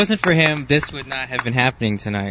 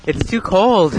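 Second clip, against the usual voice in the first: normal talking voice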